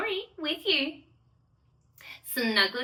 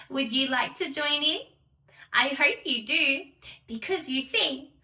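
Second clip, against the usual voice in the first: ordinary delivery, nothing marked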